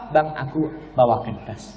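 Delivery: soft, low wifely offer; gentle dutiful wife. Acted. Wife offering to carry husband's bag, gentle. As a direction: offer help softly and sweetly, acted.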